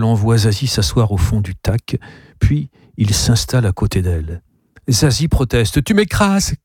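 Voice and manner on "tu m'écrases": enraged yell. A narrator's reading. Niece squashed by her uncle in the taxi, enraged yell. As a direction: protest in a child's high, angry voice. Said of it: "qu'elle hurle, folle de rage".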